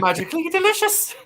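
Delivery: high, hammed-up Irish leprechaun accent; Lucky Charms guy. Teased about his Irish, he hams up a high Irish ad voice. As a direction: sing it out like a cereal-ad leprechaun in a mock Irish accent. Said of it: "your Irish sounds like the Lucky Charms guy".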